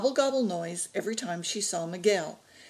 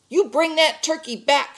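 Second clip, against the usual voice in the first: loud, high-pitched demand; shout; 9 semitones higher, 7 dB louder than usual. A narrator's reading. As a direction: shout it after a fleeing thief. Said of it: "shouted Clarine".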